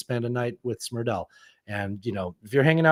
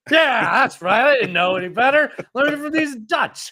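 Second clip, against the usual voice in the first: mock defensive retort; 13 semitones higher, 4 dB louder than usual. Acting out others blaming the Dutch.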